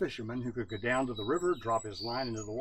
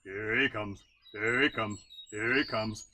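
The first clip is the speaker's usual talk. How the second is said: slightly lower, croaking lookout cry; bullfrog lookout call; 3 semitones lower than usual. Acted. Bullfrog warning the frogs that Brother Coon is coming, low call. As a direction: call it out like a big old bullfrog. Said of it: "the bullfrog would call out".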